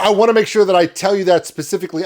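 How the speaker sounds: normal talking voice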